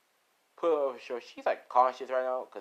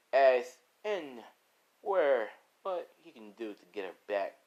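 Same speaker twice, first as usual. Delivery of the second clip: ordinary delivery, nothing marked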